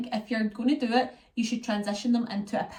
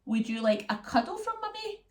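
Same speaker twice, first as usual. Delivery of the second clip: soothing mummy voice; 10 semitones higher than usual. Retold or imitated. Mum soothing toddler.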